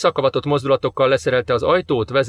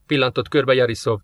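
usual speaking voice

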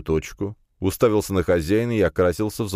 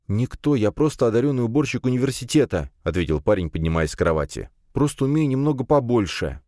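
lightly voiced youth explaining himself, a little higher; slightly higher, halting self-justification; nervous young man; 6 semitones higher than usual. (слегка взволнованный парень оправдывается)

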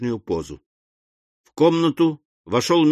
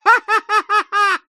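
very high, loud hysterical cackle; piercing staccato hysterical laugh; hysterical laughter; 5 dB louder than usual. A narrator's reading. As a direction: shriek with laughter in a mock fit. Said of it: "истерического смеха вперемешку с воплями".

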